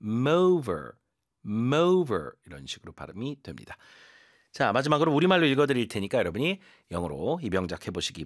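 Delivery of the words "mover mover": "mover" with the m carried from "came"; linked m sound. Shown as a demonstration. Teacher showing how "came over" becomes "mover". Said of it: "M 발음이 뒤에 살짝 붙어서".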